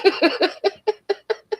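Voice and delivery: real high giggle rising up; short high laugh. Real, not acted. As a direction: dissolve into giggles. Laughing at her own comeback.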